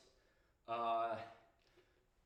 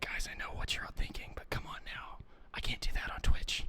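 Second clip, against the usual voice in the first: ASMR-style whisper; breathy stage whisper to chat; 7 dB louder than usual. Pretend. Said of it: "I could give you some A S M R".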